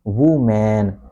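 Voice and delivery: "woman" as WOO-MAN with a full A; drawn-out MAN at the end of "woman"; wrong second vowel. Shown as a demonstration. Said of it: "It is not woman".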